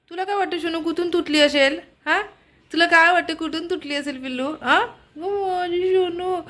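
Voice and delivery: high, cooing questions. Real, not acted. Owner asks her bird about its beak, cooing baby talk. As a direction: coo to a little pet in a high voice.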